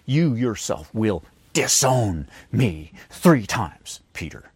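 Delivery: slow, each word leaned on, dropping to a hush on Peter; emphatic slow reading; every word emphatic. Shown as a demonstration. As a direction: spell it out word by word, slow and heavy. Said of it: "every single word in that sentence is emphatic".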